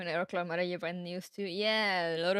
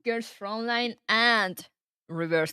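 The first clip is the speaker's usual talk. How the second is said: slow, louder drawn-out announcement; excited announcement; 4 semitones higher, 7 dB louder, slower than usual. Real, not acted. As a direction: stretch it out like big news.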